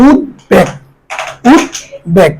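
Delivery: উদ্বেগ slowly split, "ud-beg"; pronounced b. Shown as a demonstration. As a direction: keep the b audible in উদ্বেগ. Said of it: "সেই বফালার উচ্চারণ". (উদ্বেগে ব স্পষ্ট, "উদ্-বেগ")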